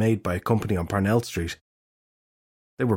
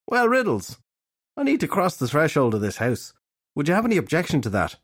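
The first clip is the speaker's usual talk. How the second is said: polite detective request; 6 semitones higher than usual. A narrator's reading.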